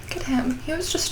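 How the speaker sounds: soft, high hushed awe. Real, not acted. Fan admiring her figure, hushed awe. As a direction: coo at the figure softly.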